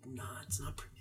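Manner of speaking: whispering. Retold or imitated. Mock quote of the man.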